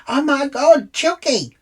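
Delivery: high, loud excited squeal of recognition; starstruck gushing. Retold or imitated. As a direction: burst out like a thrilled fan. Acted fan spotting the speaker.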